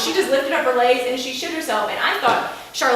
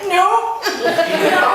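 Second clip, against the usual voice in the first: laughing denial; 7 semitones higher, 5 dB louder than usual. Retold or imitated. Laughing through tears, denial.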